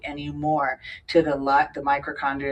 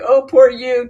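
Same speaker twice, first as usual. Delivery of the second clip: loud, high mock sympathy; exaggerated poor-thing voice; imitated pity; 7 semitones higher, 12 dB louder than usual. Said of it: "somebody's like".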